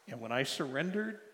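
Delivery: ordinary talk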